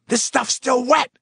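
high, loud furious yell; angry yell. Acted. Foreman yelling at the worker over wet samples. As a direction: explode at the wet paint. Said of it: "he yelled".

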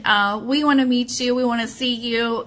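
ordinary talk

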